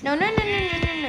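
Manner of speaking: panicked no. Real, not acted. Sheep escaping the pen.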